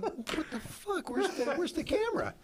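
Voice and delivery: high, squeaky bewildered search; mock panic. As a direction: imitate someone frantically finding gear missing. Retold employee finds camera missing.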